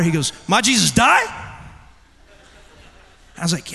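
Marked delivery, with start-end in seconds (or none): 0.5-1.2 put-on raised, higher voice of a questioning child; louder childlike question, pitched up; imitated child, louder. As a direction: mimic a boy asking more insistently. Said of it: "said it a little louder".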